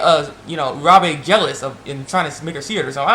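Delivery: ordinary delivery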